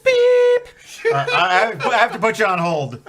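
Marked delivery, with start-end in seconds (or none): 0.0-0.7 high, loud mouth-made beep; imitated phone beep. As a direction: mimic a phone button tone. Imitates phone hold button.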